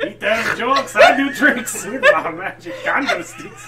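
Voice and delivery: laughing candlestick showman; Lumiere voice. Pretend. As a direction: belt it out like Lumiere.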